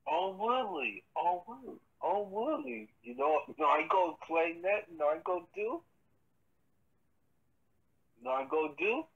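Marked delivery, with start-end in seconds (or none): none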